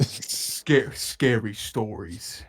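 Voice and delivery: slow, low, drawn-out creepy intro; lightly hammed-up horror-show announcer; a touch of campfire ghost-story host; spooky host voice. Spooky intro for scary stories bit.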